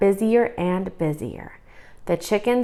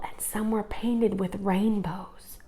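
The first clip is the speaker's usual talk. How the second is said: whispered, slow and soft; hushed wonder; 8 dB quieter than usual. A narrator's reading. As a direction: breathe it in awe. Awed description of eggs.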